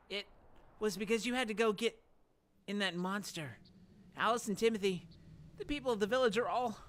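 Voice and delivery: high and loud, rising fury; angry accusation. Acted. Dan blames Faye for the village, angry and high. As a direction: build toward an outburst.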